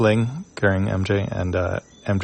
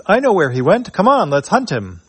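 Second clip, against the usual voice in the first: much higher robot voice, keen to lead the hunt; eager robot; 9 semitones higher, 3 dB louder than usual. Acted. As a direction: chirp it like a gleeful little robot. Potty bot voiced in comic.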